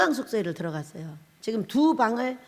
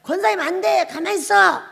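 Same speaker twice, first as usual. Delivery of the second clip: higher, bossy brush-off; bossy retort; 7 semitones higher, 5 dB louder than usual. Retold or imitated. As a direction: order her to stay out of it. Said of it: "권사님 막 화가 나가지고".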